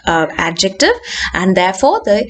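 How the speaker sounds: normal talking voice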